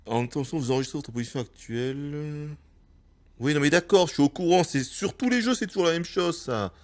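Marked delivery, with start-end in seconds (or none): 3.4-6.4 irritated. Real, not acted. Irritated reply to the tutorial.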